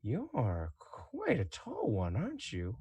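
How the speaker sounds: soft, slow, swooping appraisal; silky, savouring Inquisitor. Acted. Inquisitor sizing up a tall prisoner, soft and savouring. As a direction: toy with the captive, lingering on the words. Game master plays the Inquisitor.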